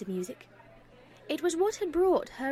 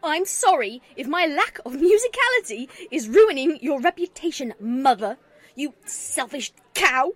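loud, higher, bitter shouting; furious outburst; 4 semitones higher, 8 dB louder than usual. A narrator's reading. Grieving daughter yelling at her selfish mother, furious. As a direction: pour out all the frustration, loud.

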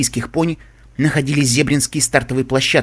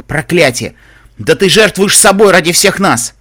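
loud, high exclamation; curse, then cry it out; impassioned outburst; 6 semitones higher, 8 dB louder than usual. A narrator's reading. Лайфблум to heroine sacrificing herself for all, impassioned outburst. Character bursts out over friend's sacrifice.

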